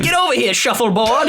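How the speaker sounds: high, laughing call to a dog; calling a pet. Pretend.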